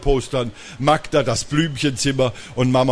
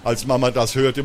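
ordinary delivery

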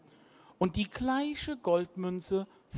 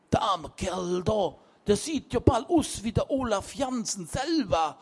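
put-on higher, louder musing young man; Martin remarking; 4 semitones higher, 5 dB louder than usual. A narrator's reading. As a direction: observe it half to himself. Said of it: "stellte Martin derweil fest".

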